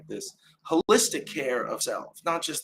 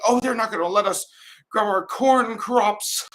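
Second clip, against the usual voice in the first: mock dramatic outrage; 8 semitones higher, 3 dB louder than usual. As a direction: ham up the panic of an old editorial. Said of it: "super dramatic thing".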